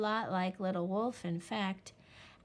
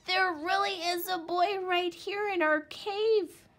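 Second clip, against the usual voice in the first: high, loud earnest plea; pleading; 10 semitones higher, 6 dB louder than usual. A narrator's reading. Little Wolf to his doubting parents, loud plea. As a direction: beg them to believe him. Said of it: "Little Wolf pleaded".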